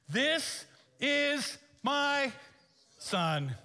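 very slow, high, loud divine declaration; booming voice of God. Acted. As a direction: declare it from the sky, drawn out. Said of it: "God himself was on the witness stand".

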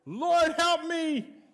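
high, loud, slow cry for help; desperate shout. Retold or imitated. People in trouble shout to God, high, loud and slow. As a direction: shout it to heaven, drawn out. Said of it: "They cried".

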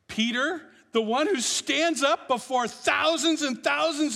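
loud, high, driving sermon voice; rising fervent proclamation; full-force preaching. Acted. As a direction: preach it out to the back row.

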